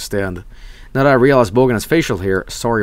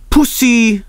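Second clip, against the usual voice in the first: high, loud blurt of the word Pussy; loud goofy shout of Pussy; loud mock call; 11 semitones higher, 5 dB louder than usual. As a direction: belt out Pussy as a joke. Repeating the name, louder.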